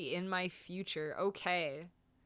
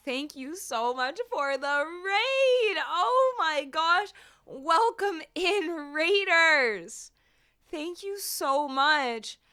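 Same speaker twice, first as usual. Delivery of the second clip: high, excited raid greeting; cute anime streamer; 8 semitones higher, 7 dB louder, slower than usual. Real, not acted. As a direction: squeal a welcome to the raiders.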